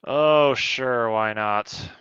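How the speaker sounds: normal talking voice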